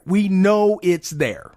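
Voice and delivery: "We know it's there" slowly, each word weighed, "know" stressed; slow it down and lean on every word; slow emphatic repeat. Real, not acted. Repeating the same insistence, slower.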